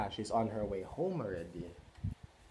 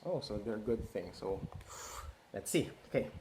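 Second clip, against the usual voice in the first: ordinary delivery, nothing marked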